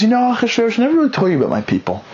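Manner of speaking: high, quick earnest plea; a queen gently breaking news; Esther pleading. Acted. Queen Esther to the king, high quick plea.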